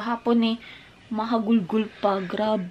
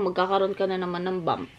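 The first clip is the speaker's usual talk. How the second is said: ordinary delivery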